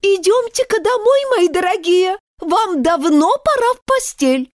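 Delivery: canary mother calling her chicks to bed, quavering; trembling little voice. Acted. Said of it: "дрожащим голоском".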